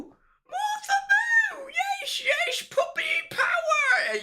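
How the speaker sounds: very high, excited dog greeting; excited squeal. Real, not acted. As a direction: squeal her name at the dog.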